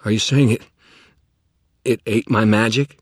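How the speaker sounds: loud alarmed question. Acted. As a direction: demand an answer, alarmed. Alarmed at loss.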